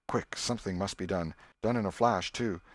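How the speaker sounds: ordinary delivery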